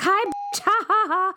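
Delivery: high mocking taunt with fake laugh; teasing a friend from a separate immigration line; gloating taunt. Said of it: "And I'll be like".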